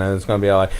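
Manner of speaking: ordinary talk, nothing marked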